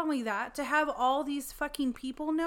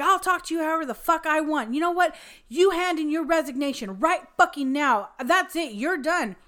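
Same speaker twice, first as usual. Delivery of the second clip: loud, raised mock tirade; retold furious yelling; 5 semitones higher, 8 dB louder than usual. Doctor firing his driver on the phone, imitated furious yell. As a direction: lay down the law, imitating an enraged boss. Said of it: "he was yelling at Brian".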